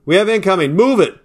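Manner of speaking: urgent shout. Acted. Soldier shouting a warning as bullets come in, urgent shout. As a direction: yell it over the gunfire. Incoming fire, urging everyone out.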